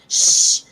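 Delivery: loud unvoiced shushing hiss; forceful imitated "shh"; sharp shush. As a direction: shush a noisy cinema row.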